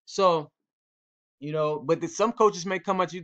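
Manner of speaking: ordinary talk, nothing marked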